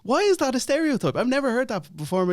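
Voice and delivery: high-pitched, baffled comic outcry; incredulous. Acted. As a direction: pitch it way up in disbelief. Mock disbelief at toaster stereotype.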